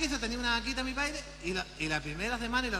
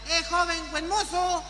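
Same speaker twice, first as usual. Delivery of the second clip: higher, louder flirty call; lady calling; 7 semitones higher, 7 dB louder, slower than usual.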